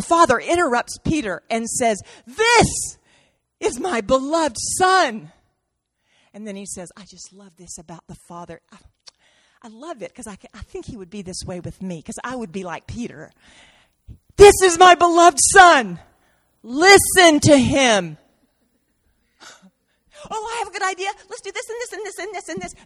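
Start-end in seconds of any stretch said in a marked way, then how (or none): 2.3-5.3 high sharp This cutting in, then firm; the Father interrupting Peter's chatter; interrupting declaration. Acted. 7.0-13.3 much softer, intimate; preacher confiding a personal aside to the congregation. Real, not acted. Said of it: "confiding aside". 14.4-18.2 the Father commanding Peter to listen; firm loud command. Acted. 20.3-22.7 high, fast, soft babbling; preacher mimicking Peter's eager rambling; mock eager babble. Mocking Peter's chatter.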